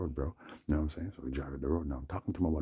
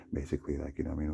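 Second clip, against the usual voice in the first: normal talking voice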